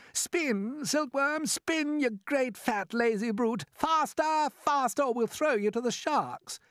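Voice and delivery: high, loud frantic yelling from below; shouting. A narrator's reading.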